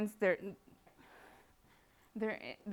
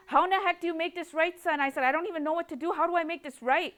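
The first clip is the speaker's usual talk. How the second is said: desperate plea; 8 semitones higher, 6 dB louder than usual. Retold or imitated. Retold pleading exchange with son.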